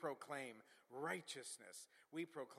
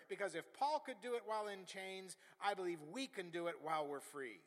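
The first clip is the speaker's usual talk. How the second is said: rousing, raised pulpit voice; impassioned preacher's exhortation; full-force preaching; 4 semitones higher, 6 dB louder than usual. Acted. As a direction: drive each phrase home to the congregation.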